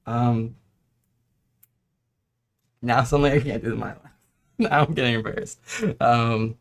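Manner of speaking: normal talking voice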